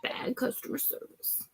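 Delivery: whispered echo of the phrase. Shown as a demonstration. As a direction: breathe it out as a soft whisper. Repeats phrase, then whispers it.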